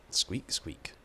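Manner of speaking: whispered squeak squeak; breathy whispered mouse noise. Acted. Imitates mouse.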